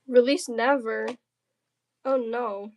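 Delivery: usual speaking voice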